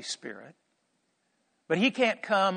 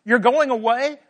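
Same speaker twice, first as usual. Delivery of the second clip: high, loud, incredulous questions; urgent pleading disbelief, pitched up; disciples' alarmed questioning; 7 semitones higher, 7 dB louder than usual. Acted. Disciples questioning Jesus about his leaving, alarmed and loud. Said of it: "they said things like".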